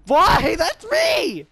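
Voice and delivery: high loud shocked outburst; shocked cry. Real, not acted. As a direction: protest it in disbelief. Shocked outburst after being killed.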